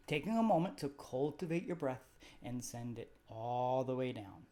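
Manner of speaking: calm, even meditation guide voice; guided meditation. Acted. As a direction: gently lead the listener through the body scan. Leads a guided body scan.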